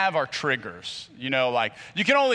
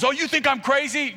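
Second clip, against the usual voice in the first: offended retort; 11 semitones higher than usual. Shown as a demonstration. Showing how a hothead answers being called crazy. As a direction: throw it back, offended. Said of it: "your response is".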